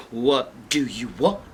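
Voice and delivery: low bear voices echoing her; indignant bears. Acted. Bears repeat the girl's question, low and indignant. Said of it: "And the bears say".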